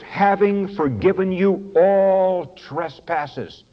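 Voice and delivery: all held long, slow and falling; slow, lean hard on all; stress on all. Shown as a demonstration. Preacher reading verse to congregation, stressing all. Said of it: "You see the word all".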